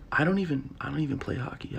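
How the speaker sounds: quiet confession. Acted. Confessing quietly, dropping the act.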